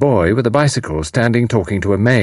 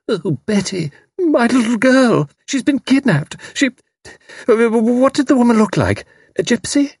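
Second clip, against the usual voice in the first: very high, wailing panic; distraught mother. A narrator's reading. Mother collapses against friend over kidnapped child, distraught. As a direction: wail the name, then stammer out the question.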